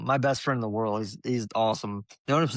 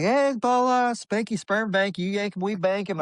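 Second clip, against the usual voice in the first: much higher, silly put-on caller voice; prank phone voice; 9 semitones higher than usual. Answering a friend's phone, faking a silly business greeting. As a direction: pose as the sperm bank on the phone. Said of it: "I acted like I was him".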